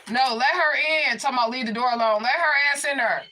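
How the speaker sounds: loud, high call to someone in the next room. Real, not acted. Calling across the house to let a woman in, loud and high. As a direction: shout past the mic to let her in.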